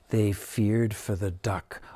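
ordinary delivery

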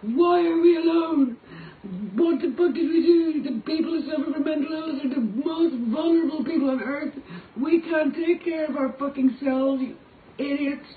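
a desperate tearful cry for help; crying plea. Real, not acted. Desperate plea about mental illness.

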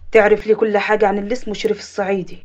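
low man's voice giving instructions; deep, controlled phone command; commanding order. Acted. Boss orders investigation by phone.

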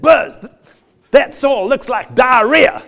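imitated farmer exclaiming loudly; mock exclamation. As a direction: call it out as the farmer's startled verdict. Retelling farmer friend's exclamation.

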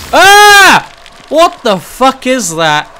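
high, loud shriek, then slow shouted disbelief; real jump-scare outburst; startled scream. Real, not acted. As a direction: scream in shock, then demand an answer. Startled by something in game.

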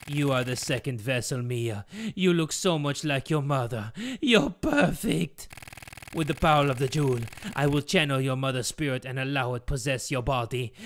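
low villain father in a put-on accent; hammed-up accented villain; villainous Geppetto, menacing. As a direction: lay out the evil plan with relish. Geppetto reveals plan to Mia.

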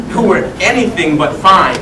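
ordinary talk, nothing marked

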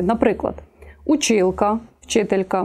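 normal talking voice

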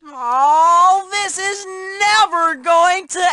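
long wail dropping away at the end; despairing wail. Acted. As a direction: wail as if it will last forever. Despairing wail at endless ordeal.

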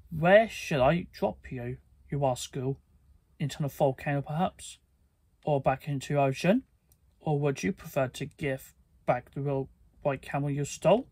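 usual speaking voice

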